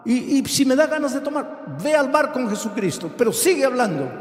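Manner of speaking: high, urgent preacher's plea; impassioned preaching. Acted. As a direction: build into a fervent, high-pitched prayer to God. Preaches the model prayer at full force.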